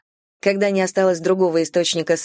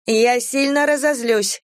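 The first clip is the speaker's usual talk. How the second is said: high, slowed warning; woman in peril; 6 semitones higher, slower than usual. A narrator's reading. Vanetta threatens her rescuers from below, high slow voice. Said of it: "послышался из темноты голос Ванетты".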